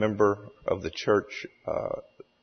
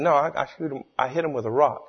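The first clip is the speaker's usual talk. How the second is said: higher, protesting mock-Cajun voice; Cajun storyteller; 6 semitones higher, faster than usual. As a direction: insist on it in lightly faked Cajun. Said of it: "tells the story in his hilarious Cajun accent".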